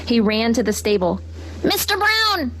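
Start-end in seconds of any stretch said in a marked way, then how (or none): 1.6-2.5 high call across the yard. A narrator's reading. Henry calls for Mister Brown, high call. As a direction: call for the horse. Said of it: "he called".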